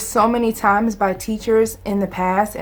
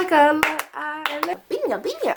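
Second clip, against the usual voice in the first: put-on kids' show voice; 7 semitones higher than usual. Continues the show character voice.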